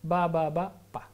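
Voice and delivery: "ba, ba, ba" then a sharper "pa", each syllable separate; repeated syllables. Shown as a demonstration. Professor demonstrating the sound stimulus for a baby, syllable by syllable. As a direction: tap out "ba" three times, then switch to "pa". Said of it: "de vez en cuando le pongo pa".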